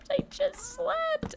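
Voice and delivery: very high, slow mock whine; squeaky mock complaint about sleeping. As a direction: squeak it in disbelief. Repeated in squeak.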